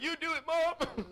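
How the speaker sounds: high, drawn-out mock plea; begging kid. Child begging mom to punish instead of dad, high pleading. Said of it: "I used to beg my mother".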